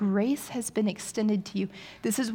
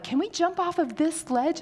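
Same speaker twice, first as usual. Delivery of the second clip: imitating kids; 8 semitones higher than usual. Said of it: "when they were like".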